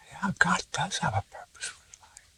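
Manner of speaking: hushed breathy whisper of wonder; awed whisper. Retold or imitated. Mother whispering back to the doctor, awed. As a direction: breathe it out in quiet awe.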